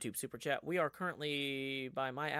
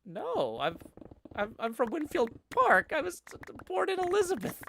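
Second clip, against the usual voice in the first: put-on high, loud New Jersey accent; mom's New Jersey accent; 8 dB louder than usual. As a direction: mimic mom insisting in her Jersey accent. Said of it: "thick New Jersey accent".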